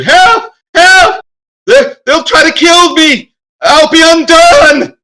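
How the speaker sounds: high, desperate cries for rescue; screaming for help. Acted. Older man under attack calls to approaching riders, screaming for help. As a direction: scream in panic as if about to be killed. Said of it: "starts screaming at you".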